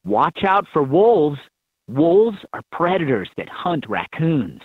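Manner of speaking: normal talking voice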